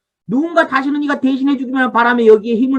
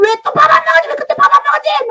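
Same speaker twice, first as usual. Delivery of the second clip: very high-pitched mock nagging; angry parent; 4 dB louder, faster than usual. Parent berating a child over a skipped meal, angry. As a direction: scold like a fed-up parent. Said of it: "막 그러면서 또 화를 내면서".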